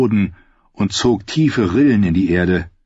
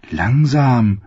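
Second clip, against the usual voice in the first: put-on girl's caution; 4 semitones higher than usual. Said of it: "sagte das Mädchen".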